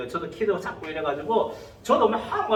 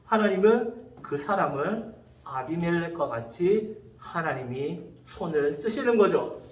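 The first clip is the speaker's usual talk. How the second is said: ordinary talk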